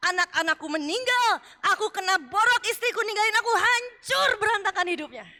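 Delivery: loud, high, protesting cry; Job's anguished complaint. Acted. Job crying out to God that he was righteous, loud and aggrieved. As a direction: plead and protest at God, hurt and indignant.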